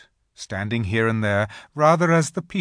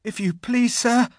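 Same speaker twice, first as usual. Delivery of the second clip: high, timid trembling voice; 13 semitones higher, 4 dB louder than usual. A narrator's reading. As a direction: stammer it out in fear. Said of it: "trembling so that he could hardly speak".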